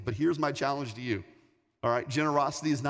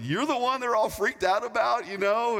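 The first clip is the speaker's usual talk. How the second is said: high-pitched teasing exclamation; amused disbelief; 8 semitones higher, 4 dB louder than usual. Retold or imitated. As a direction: tease a friend, pitched up and animated. Laughing, teasing the intruder he knows.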